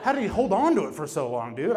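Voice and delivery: normal talking voice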